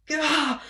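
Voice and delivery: exasperated little ugh; frustrated ugh. Retold or imitated. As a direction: groan out your frustration, half-playful. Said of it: "we're like".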